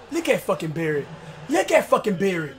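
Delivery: muttered disbelief. Real, not acted. Quiet disbelief.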